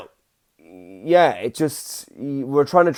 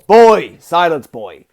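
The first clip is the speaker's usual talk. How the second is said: a louder, barked Kratos line; 3 semitones higher, 10 dB louder, slower than usual. Retold or imitated. Gamer father imitates Kratos scolding his son, louder. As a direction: command the boy like Kratos. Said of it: "like Kratos".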